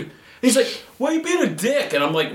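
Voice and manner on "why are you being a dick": high-pitched mock outrage; indignant. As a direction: retell it as an offended guy confronting you. Angry stranger confronts him in retelling.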